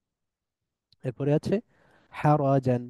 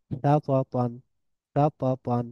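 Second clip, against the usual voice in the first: normal talking voice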